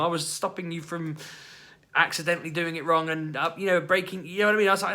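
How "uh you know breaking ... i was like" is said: ordinary talk, nothing marked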